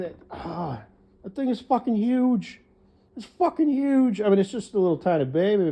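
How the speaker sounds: high, incredulous astonishment; amazed. Real, not acted. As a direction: marvel aloud in disbelief. Amazed at babies being born.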